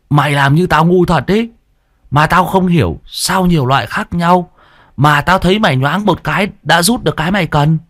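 put-on squeaky whiny boy. A narrator's reading. Sidekick complaining about chewed leaves, high whiny voice. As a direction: gripe like a spoiled kid. Tý retorts.

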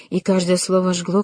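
plain reading voice

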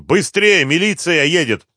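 high, loud urgent warning; shouting. A narrator's reading. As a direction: shout to hurry the gang out. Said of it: "закричал он".

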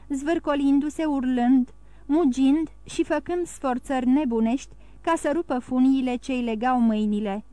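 usual speaking voice

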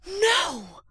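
put-on high, loud child's cry of protest; a little boy upset and pleading; gasped no. A narrator's reading. Boy protesting to his father, a loud high gasping cry. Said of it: "Ah Ling gasped".

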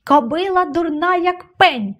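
high-pitched insulting shout, volume contained; storyteller's high angry exclamation; exasperated cry. A narrator's reading. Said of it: "крикнула вона".

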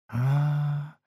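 soft, drawn-out disappointed sound; disappointed drawl. A narrator's reading. Little prince hears the geographer doesn't know, mild disappointed drawl. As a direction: let it trail out softly, a touch let down. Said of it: "розчаровано протягнув маленький принц".

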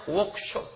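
"Workshop" with a hushed sh, like Arabic shin; softly breathed "Workshop" showing the sh; shin sound. Shown as a demonstration. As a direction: lean on the sh in "Workshop". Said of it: "ada huruf shin".

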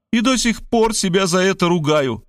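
high, theatrical self-reproach; with pathos. A narrator's reading. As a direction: confess it melodramatically, pitch up. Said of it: "с патетикой воскликнула она".

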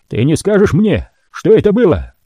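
a wary mutant prisoner; Mozes character voice. A narrator's reading.

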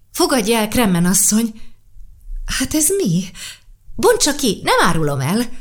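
put-on a child correcting a grown-up, bright and sure; little girl voice. Sasha correcting old Karl about the book, lightly voiced little girl.

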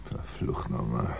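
hushed, slow muttered curse to himself. Acted. Alone in the flat, Ed curses softly to himself. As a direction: swear under your breath. Alone, cursing under breath.

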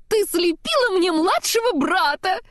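high, bright snowman delight; joyful exclaim. A narrator's reading. Olaf thrilled at Elsa's new giant snowman, high and gleeful. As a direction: squeal it out with glee. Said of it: "радостно воскликнул Олаф".

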